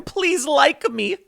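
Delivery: high, slow, whiny plea; mock begging. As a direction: ham it up like someone begging for approval. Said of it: "he begs them".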